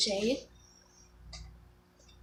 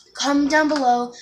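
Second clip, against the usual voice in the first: louder, higher call to viewers; excited announcement; 4 semitones higher, 9 dB louder than usual. Real, not acted. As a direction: announce it with energy.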